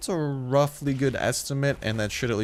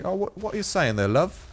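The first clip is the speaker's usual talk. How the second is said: higher-pitched, chummy British greeting; cheeky put-on British voice; British accent; 8 semitones higher than usual. Shown as a demonstration. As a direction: slip into a playful British accent. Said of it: "talking like a true Brit".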